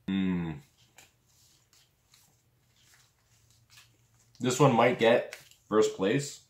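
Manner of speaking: talking while chewing meat; eating jerky between words; mouth full chewing. Real, not acted. Seen 3: bites stick at +1.3, chews, later bites again at +12.9.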